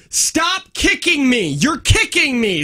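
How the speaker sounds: put-on high, slow, drawn-out child's yell; mimicked toddler yell. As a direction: whine it out like a toddler being dressed. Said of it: "she yelled".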